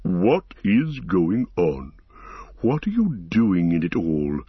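ancient tree-creature puzzling over news, deliberate and resonant; ponderous forest giant, inquisitive; Treebeard, curious and musing. Acted. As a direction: stretch the questions out, then wander off into musing. Voices Treebeard questioning and musing.

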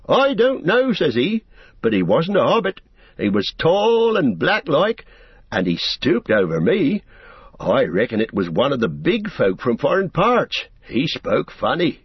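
put-on old gaffer voice. A narrator's reading. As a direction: play a chatty old country dad. Gaffer describes the stranger.

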